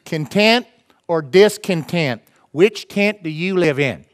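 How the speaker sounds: slow, drawn-out Southern preacher parody; hammed-up Southern pulpit accent. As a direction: imitate a Southern preacher, stretching the words. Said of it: "joking about a Southern preacher".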